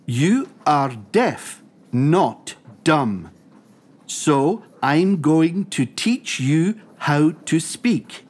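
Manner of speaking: slow, deliberate, separated words; teaching deaf pupils. Acted. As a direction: space out each phrase for lip-readers.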